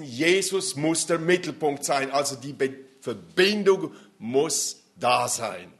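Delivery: full-voiced sermon delivery; preaching. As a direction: preach it out to the room. Preaching to the congregation.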